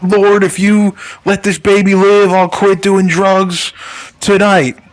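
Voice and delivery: high, slow, pleading mock prayer. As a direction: beg the Lord like a televangelist.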